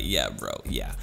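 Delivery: low, quiet, laid-back yeah bro. Retold or imitated. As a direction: drop down into a chill dude voice. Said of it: "I was like".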